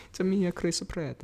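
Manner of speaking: put-on soft, lightly sketched Mario accent. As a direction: quietly play Pratt as Mario. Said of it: "the voice of the character".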